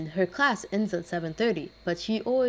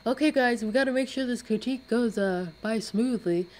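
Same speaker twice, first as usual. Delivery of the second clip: higher, louder mock teacher voice; mocking the hated teacher, high and loud; imitating teacher; 5 semitones higher, 4 dB louder than usual. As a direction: lampoon the bossy teacher. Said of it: "She's like".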